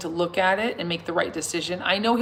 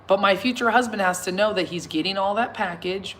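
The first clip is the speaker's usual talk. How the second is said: ordinary delivery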